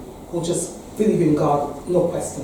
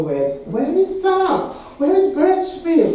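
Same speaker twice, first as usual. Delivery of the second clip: high, drawn-out incredulous question; mock puzzlement; 12 semitones higher, 4 dB louder, slower than usual. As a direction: imitate baffled people asking where on earth. Voicing listeners' puzzled question.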